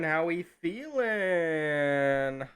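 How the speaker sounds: very slow, stretched-out hype greeting; drawn-out greeting. Acted. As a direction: drag out every word like a host.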